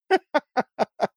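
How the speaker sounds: high laugh. Real, not acted. Laughing at his own clip.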